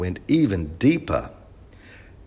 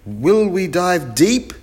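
high, slow, loud question; shouted question; 11 semitones higher, 7 dB louder, slower than usual. A narrator's reading. Chip asks about the dive, slow high shout. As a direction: shout it slowly with pitch held up. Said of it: "shouted Chip".

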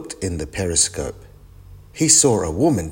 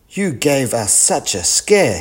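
mildly cross; 6 semitones higher, 3 dB louder than usual. Acted. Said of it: "Dad was cross".

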